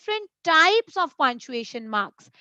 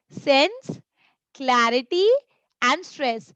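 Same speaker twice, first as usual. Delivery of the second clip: each word set apart, rising then falling on stress; pausing at commas; slower than usual. Shown as a demonstration. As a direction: separate sense, clarity, stress with pauses. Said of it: "These are three different words".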